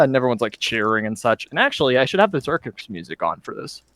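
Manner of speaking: ordinary talk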